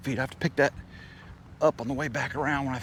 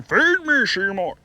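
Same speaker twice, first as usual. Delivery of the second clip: donkey voice; 8 semitones higher, 3 dB louder, slower than usual. Retold or imitated. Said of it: "They're saying".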